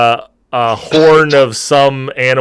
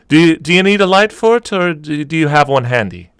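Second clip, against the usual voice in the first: barmaid voice; 6 semitones higher than usual. Acted. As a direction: gently offer the whole box to a regular.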